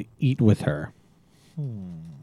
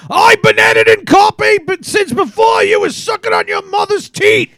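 shouted Scottish editor, much higher and louder, slow; hammed-up angry Scottish brogue; Scottish accent; 6 dB louder, slower than usual. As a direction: rant like an outraged Scots sub-editor. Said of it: "[name], you're gonna do the imitation".

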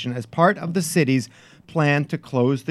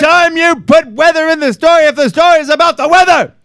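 high, loud Scottish editor ranting; hammed-up Scottish tirade; Scottish accent; 12 semitones higher, 9 dB louder than usual. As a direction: rant like an irate Scots sub-editor. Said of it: "he's just gonna go in there and say this".

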